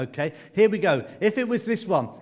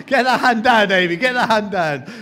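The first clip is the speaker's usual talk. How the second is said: loud, higher-pitched mock telling-off; playful scolding shout; 3 semitones higher, 9 dB louder than usual. Real, not acted. Host jokingly orders Amy's hand down, loud scolding. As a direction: call it out sharply over laughter.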